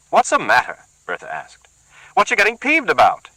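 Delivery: high, loud accusing woman; suspicious woman. Acted. Bertha accuses the muttering man, high and loud. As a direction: bristle with suspicion.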